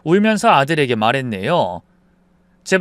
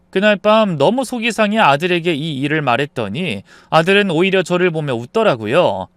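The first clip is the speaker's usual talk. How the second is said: usual speaking voice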